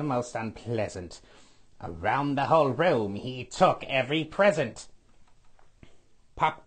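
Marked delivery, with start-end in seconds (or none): none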